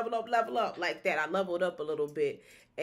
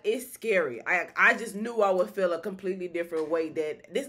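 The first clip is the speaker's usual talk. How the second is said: normal talking voice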